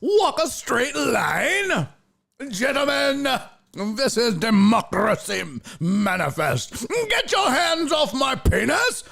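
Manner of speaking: high, theatrical mock outrage; hammed-up impression with stretched, ringing words; Jack Carlson. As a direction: proclaim it like a grandiose orator under arrest. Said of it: "I'm doing this accent".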